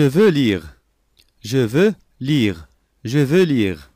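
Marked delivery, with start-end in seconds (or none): none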